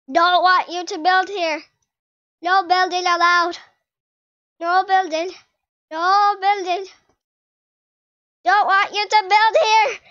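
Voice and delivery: loud, firm telling-off; cross scolding. Real, not acted. Gamer telling rival not to build here, cross scolding. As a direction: lay down the law, loudly.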